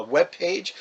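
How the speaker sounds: ordinary delivery, nothing marked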